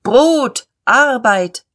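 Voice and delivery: rolling demonstrators' cry; swelling crowd shout. Acted. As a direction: call the slogan out to the masses. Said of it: "anschwellend, über der Menge hinrollend".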